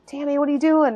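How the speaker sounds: high-pitched, exasperated quote; imitated reproach. Others questioning Tammy over the wait, imitated exasperation. Retelling others confronting her.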